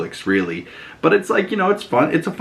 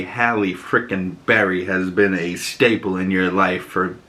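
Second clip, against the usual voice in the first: normal talking voice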